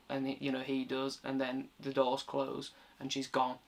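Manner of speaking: subdued, soft and a bit moved; getting emotional. Real, not acted. Reviewer retelling the doors closing on the wife, quietly emotional. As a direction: let the volume sink as the sad moment lands. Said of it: "getting a bit emotional talking about it".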